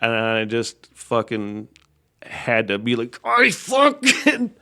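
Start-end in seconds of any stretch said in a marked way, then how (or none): 3.2-4.5 high, drawn-out mock fury held back; comically restrained outburst. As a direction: act out barely restrained rage, slowly. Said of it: "restrained myself in probably the most comical way possible".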